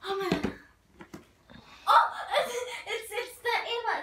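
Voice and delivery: high, loud delighted gasp; thrilled, almost squealing; delighted opening a present. Real, not acted. Delight at friend's slime.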